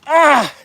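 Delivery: high, sudden "ah"; high yelp of exertion; frustrated cry. Real, not acted. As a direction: cry out as it slips away. Fish escapes the net again.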